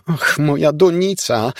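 wysoki, teatralny lament; przesadna rozpacz. A narrator's reading. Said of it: "z przesadną rozpaczą". (teatralna rozpacz ciotki)

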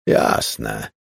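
a low, strangled "Jasne" forced out; choked voice. A narrator's reading. Raymond answers Laura's garlic jab, choked. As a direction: swallow his anger and choke the word out. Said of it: "odparł zdławionym głosem".

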